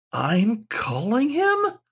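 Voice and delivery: high, slow, whimpering disbelief; puppyish whimper. A narrator's reading. Dog-man whimpering at learning he summons the ghost. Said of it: "a puppyish whimper out of his voice".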